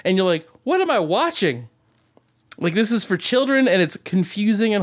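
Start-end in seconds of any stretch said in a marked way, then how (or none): 0.6-1.7 mock bewilderment. Acted baffled viewer reaction.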